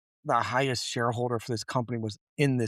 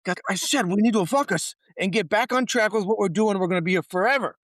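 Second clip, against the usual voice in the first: higher and louder, fed up; exasperated; 8 semitones higher, 5 dB louder than usual. Retold or imitated. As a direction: spell it out, losing patience. Exasperated partner repeats himself.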